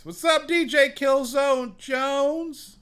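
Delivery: calling out high, loud and slow; loud drawn-out greeting. Real, not acted. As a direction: hype up the guest's name like an announcer. Greeting a friend on the show.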